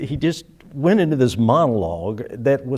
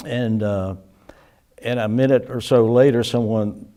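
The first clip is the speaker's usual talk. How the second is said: normal talking voice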